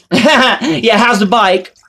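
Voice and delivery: high, loud sneering brush-off; mocking flippant reply. Mocking how the brother shrugged off his injured friend, high and flippant. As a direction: toss off the question in a high, careless mock voice. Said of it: "My brother put".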